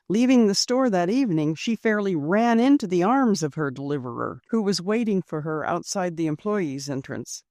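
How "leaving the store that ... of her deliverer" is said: ordinary delivery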